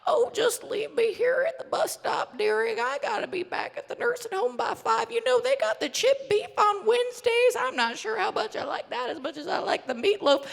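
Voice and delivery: put-on high, little old lady voice; a sweet rambling grandma at the bus stop; tiny grandma voice. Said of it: "the tiniest little grandma voices".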